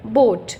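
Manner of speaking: बोट with a long open O, not बुट; long vowel demo. Shown as a demonstration. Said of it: "बुट नहीं कहते हम". (बोट को लंबा खींचकर बोलना)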